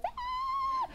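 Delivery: piercing high mermaid screech; ear-splitting squeal in a high pitch; mermaid shriek. Retold or imitated. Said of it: "And she goes".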